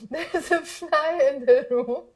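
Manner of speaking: giggling through the words; laughing at her own silly idea. Real, not acted. Teacher laughing while telling her fly story. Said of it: "I came up with this idea now".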